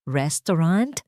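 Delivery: ordinary delivery, nothing marked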